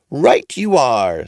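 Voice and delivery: high, drawn-out agreement; odd old man approving the boy; funny old man, slow. A narrator's reading. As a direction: draw it out knowingly. Old man speaks slowly.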